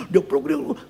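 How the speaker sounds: high-pitched words through laughter; laughing. Real, not acted. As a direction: crack up while talking. Joking, breaks into laughter.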